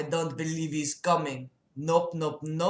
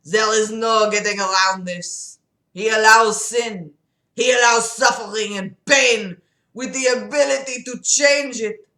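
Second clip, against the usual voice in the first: put-on emphatic French-accented preaching; 3 dB louder than usual. Patient arguing God allows sin, emphatic French accent. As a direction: hammer the key words in a thick French accent. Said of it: "that was totally French".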